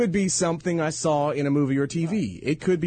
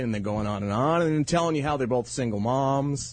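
usual speaking voice

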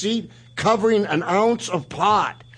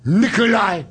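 higher, mock broken-English rant at Nikolai; Sheik insulting Nikolai; 7 semitones higher than usual. The Sheik scolding his tag partner, in a lightly voiced accent. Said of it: "the Sheik would come back".

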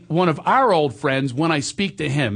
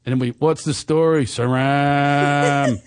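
Frank from ninth grade; much slower than usual. Retold or imitated. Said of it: "I do Frank from ninth grade".

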